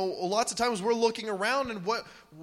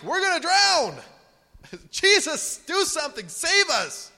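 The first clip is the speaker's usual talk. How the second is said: panicked plea; 9 semitones higher, 6 dB louder than usual. Retold or imitated. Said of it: "they're like".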